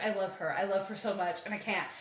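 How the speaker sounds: low, soft retold declaration of love; quietly acting out a heartfelt admission; hushed confession. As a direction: voice a lovesick man, quietly. Voicing lovesick man's confession in story.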